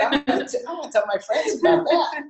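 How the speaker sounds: high, laughing through the driver's words; can barely get it out for laughing; laughing quote. Retold or imitated. Quoting the Roach Coach driver, high, laughing through it.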